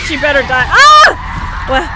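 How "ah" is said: very high, very loud scream; sudden shriek. Real, not acted. Attacked by the boss.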